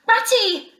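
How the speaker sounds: high, loud excited cry to a friend up ahead; Mole's high voice shouting joyfully; joyful call. A narrator's reading. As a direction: call out with sudden delight. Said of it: "he called, full of joyful excitement".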